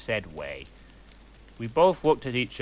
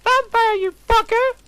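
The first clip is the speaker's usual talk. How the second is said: very high, very loud furious squeal; outraged shriek; 12 dB louder than usual. A narrator's reading. Narrator slaps Vampire, screeching furiously. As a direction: screech it as you slap him.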